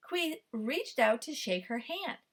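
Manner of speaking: ordinary delivery, nothing marked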